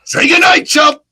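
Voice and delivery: high, loud boxer's shouting taunt; furious fighter's roar; Balrog voice. Shown as a demonstration. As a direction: bellow it like a brawling champ in the ring, stretching out the last words. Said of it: "So I'm Balrog".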